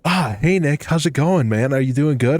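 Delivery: high, loud, overly cheery greeting; mock the boss's bright enthusiasm; sing-song fake cheer at work; chipper manager. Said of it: "he's all chipper".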